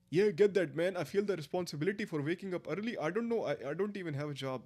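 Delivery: put-on high, singsong Indian accent. Reacting to chat about waking up early, in an Indian accent. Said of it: "what the Indian accent?".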